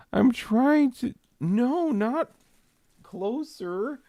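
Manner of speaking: very high-pitched, strained whine of frustration; helplessly fed up, voice pushed way up high; exasperated. Real, not acted. Fighting cat at the computer.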